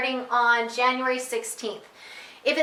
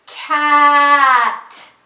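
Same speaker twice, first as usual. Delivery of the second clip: drawn-out sounds, louder, without a break; stretched slow blend; 3 semitones higher, 10 dB louder than usual. Shown as a demonstration. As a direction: stretch every sound but keep them joined. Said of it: "say it slowly first like this".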